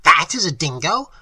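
loud, incredulous scornful question. A narrator's reading. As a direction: fling it out as a mocking question. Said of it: "cried Professor Small scornfully".

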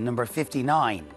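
slow, measured bingo calls; bingo caller. Acted. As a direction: call each number out clearly with pauses.

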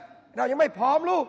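slow, drawn-out mock mother; mother's reply. Preacher mimics the aunt slowly answering her daughter. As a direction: slowly stall the daughter like a hesitant mom. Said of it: "มะจะบอกว่า".

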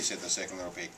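usual speaking voice

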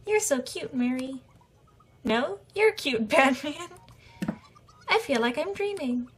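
high sweet cooing voices; lovey-dovey couple. Acted. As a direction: coo like lovestruck sweethearts. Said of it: "Lovey-dovey couple".

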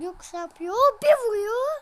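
loud, high, thrilled commentary; excited shout. Real, not acted. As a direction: shout it like the best strike ever. Replaying the goal excitedly.

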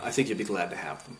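usual speaking voice

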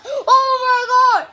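very high, loud crying shriek; ecstatic shriek. Real, not acted. Gamer who just got quadrillion, ecstatic shriek. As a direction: scream with disbelieving joy.

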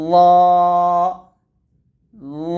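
ল as a thick emphatic Arabic dh with a long rounded "aw", said twice; heavy zwa demonstration. Shown as a demonstration. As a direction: hold each ল heavy and steady. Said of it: "এটা হবে". (মোটা, টানা যোয়া উচ্চারণ)

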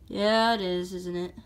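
normal talking voice

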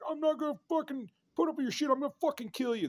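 very high, mock-raging shriek of a threat; over-the-top high-pitched hothead; fake death threat. Shown as a demonstration. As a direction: screech it way up high, hammed-up fury. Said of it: "That's not the voice of death".

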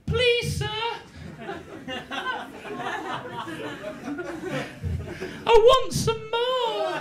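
high, drawn-out plea; pleading boy Oliver. Acted. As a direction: beg meekly in a high child's voice. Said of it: "And Oliver just goes".

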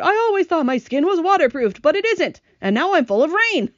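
high, loud complaining groan; groaning earthworm. A narrator's reading. Earthworm full of rain complaining, high groaning wail. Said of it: "the earthworm groaned".

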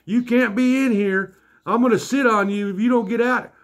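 high-pitched mock holler; retold yelling. Large Marge coming after him, high mock yelling.

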